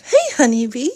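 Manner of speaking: ordinary delivery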